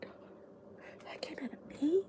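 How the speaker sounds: whispered, unvoiced disbelief; shocked whisper. Host recalling her own shock at the noise, whispered. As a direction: gasp it in a hushed whisper.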